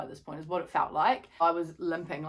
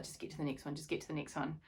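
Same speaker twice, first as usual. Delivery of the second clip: muted self-talk; 2 semitones lower, 8 dB quieter, faster than usual. Retold or imitated. Retold self-talk during the race.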